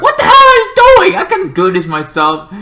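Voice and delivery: very high, loud squeaky outburst; annoyed hero. Retold or imitated. Hero scolding Green Boy for interfering, loud and high. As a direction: snap at the rescuer like an offended hero. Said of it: "He's like".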